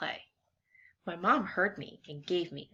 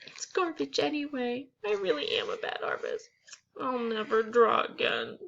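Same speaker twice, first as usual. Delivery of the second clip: put-on higher, hurt little-kid voice; upset kid voice; 6 semitones higher than usual. Sad girl about her drawing, lightly voiced. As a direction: sound like a child who gave up.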